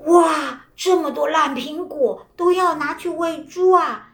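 high, astonished exclamation; surprised. A narrator's reading. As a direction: gasp in surprise at the waste. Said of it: "老先生立刻惊讶地说".

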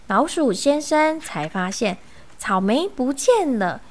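plain reading voice

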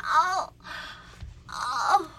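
high drawn-out groan of pain; repeated theatrical ows; imitated neighbour's groaning; mock pain moan. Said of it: "这种呻吟声".

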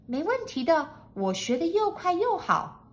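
high-pitched, confident maid; high, certain maid's voice; sure, assuring maid. A narrator's reading. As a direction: answer high with full assurance. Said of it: "语带肯定的回答".

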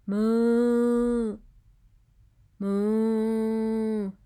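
long, drawn-out low moo; cow mooing. Shown as a demonstration. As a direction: imitate a cow for small children. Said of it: "it's a cow".

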